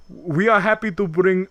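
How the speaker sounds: hammed-up French accent; bad French accent. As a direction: imitate a Frenchman struggling in English. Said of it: "French guy who can't speak English very well".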